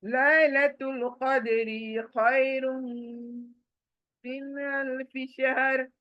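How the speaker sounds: slow, melodic tajweed recitation; Quranic recitation. Shown as a demonstration. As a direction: chant it the way it is recited.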